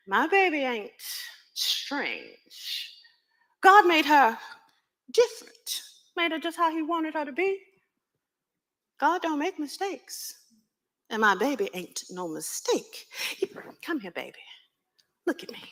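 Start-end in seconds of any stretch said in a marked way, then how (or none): 0.1-10.3 higher, firm and proud mother's voice; mother defending her daughter to John. Acted. 13.8-15.6 soft, hushed and loving; mother whispering to her daughter; tender whisper. Acted. Mother calling child close.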